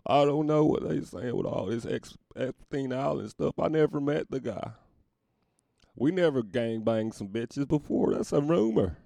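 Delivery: high, loud Southern Clinton voice; Bill Clinton impression. Bill Clinton denying rumors, Southern politician accent. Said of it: "Larry Bird sounds like Bill Clinton".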